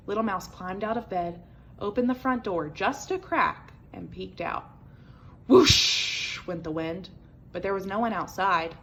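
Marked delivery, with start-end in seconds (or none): 5.5-7.1 slow, loud whoosh; whooshing wind. A narrator's reading. Wind sound in story.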